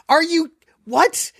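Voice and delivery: high, drawn-out disbelieving question; stunned, halting retort; mock incredulity. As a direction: imitate baffled friends, pitch leaping up. Friends baffled by driver's request.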